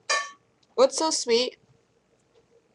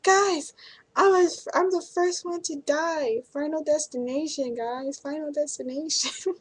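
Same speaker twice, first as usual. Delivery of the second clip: much higher, hammed-up doom on the bus; silly mock panic; 6 semitones higher than usual. Stuck on the bus in a tornado warning, mock panic. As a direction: play at being doomed, half laughing. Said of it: "being silly".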